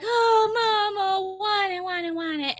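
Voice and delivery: whiny, wailing little-kid impression; whining toddler. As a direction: imitate a toddler whining for mama. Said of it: "he's whining and he's like".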